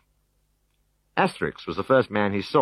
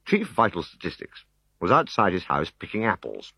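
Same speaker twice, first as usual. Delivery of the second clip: usual speaking voice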